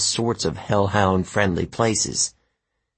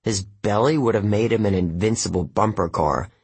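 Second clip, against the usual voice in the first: plain reading voice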